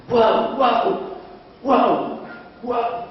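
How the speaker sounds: high woofs fading out; imitated barking. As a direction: ghost dog barking close to the ear, high and fading. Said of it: "sus ladridos junto a mi oreja".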